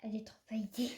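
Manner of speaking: whispered, almost no voice; whispering. Real, not acted. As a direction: breathe it out softly. Repeats word in a whisper.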